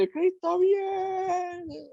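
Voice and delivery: very high, drawn-out evangelist cry; Gigi Ávila preaching. Retold or imitated. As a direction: stretch it out like a revival preacher's finale. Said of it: "terminaba diciendo". (grito de predicador evangélico muy agudo y lento)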